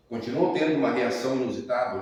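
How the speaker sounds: normal talking voice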